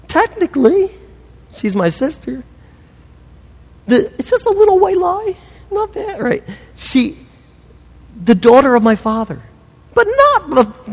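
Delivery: very high, squirming excuse voice; mock weaselly Abraham. Abraham to the king about his sister, high mock wheedling.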